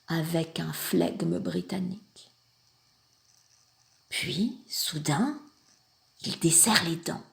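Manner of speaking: very soft, halting storytelling; hushed suspense. A narrator's reading. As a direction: drop low and quiet, letting suspense build. Suspenseful build-up to Englishman's murmur.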